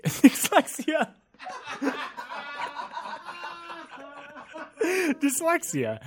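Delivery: "Dyslexia" very slow through real laughter; laughing. Real, not acted. Host fails the take, cracking up on dyslexia. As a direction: break up laughing on the word. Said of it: "I cannot say it".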